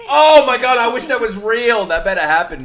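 high, loud gush of delight; overjoyed fan squeal; thrilled outburst. Real, not acted. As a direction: burst out with real joy. Thrilled reaction.

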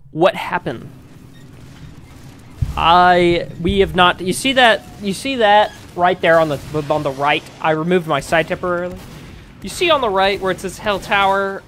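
ordinary talk

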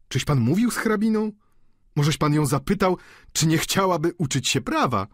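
wysoko, głośniej, kpiąco; drwiąca zaczepka. Acted. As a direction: dokuczaj studentowi. Pani Vauquer kpi ze studenta. (kpiąca gospodyni drwi ze studenta, wysokim głosem)

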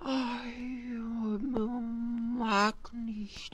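very soft sleepy refusal; drowsy. Acted. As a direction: mumble it half asleep. (schläfrig gemurmelt)

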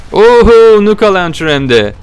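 high, loud delighted exclamation; thrilled gamer shout; excited whoop. Real, not acted. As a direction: cheer at the lucky find. Finds a rare weapon in game.

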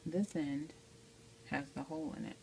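usual speaking voice

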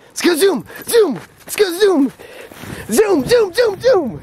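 high, bouncy voice to rile up the dog; sing-song hype for a puppy; excited dog talk. Real, not acted. Owner cheering her dog on to run, high and excited.

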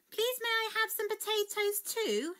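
put-on high-pitched, polite little girl. A narrator's reading. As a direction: ask nicely in a high child's voice. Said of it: "she asked politely".

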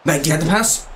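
ordinary talk, nothing marked

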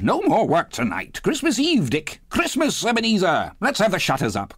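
high-pitched, merry boss's holler; Fezziwig's jovial cry. A narrator's reading. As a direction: call the holiday out with hearty glee. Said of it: "cried old Fezziwig".

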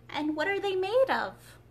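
eager, high question; curious. A narrator's reading. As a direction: lean in, full of curiosity. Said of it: "Alice asked in a tone of great curiosity".

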